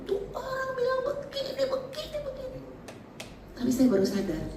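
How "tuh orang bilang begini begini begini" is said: high, wailing retold complaint; mock crying complaint. Crying to her husband about what the friend said. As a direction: whine it out, high and teary. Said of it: "aku nangis, aku bilang sama suamiku".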